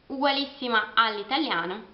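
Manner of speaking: ordinary talk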